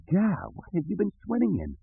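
high-pitched, appalled exclamation; disgusted. Acted. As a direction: recoil from a bad smell. Leia recoils at the sewer stink.

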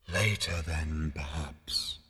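low, slow, soft ethereal voice; ghostly murmur. A narrator's reading. Unseen voice putting Zaphod off, murmuring. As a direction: let it fade away gently. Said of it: "murmured the voice".

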